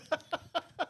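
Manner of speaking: squeaky laugh up high; high burst of laughter. Real, not acted. Host cracks up at the Irish accent. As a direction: laugh at the co-host's accent attempt. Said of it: "It's not bad".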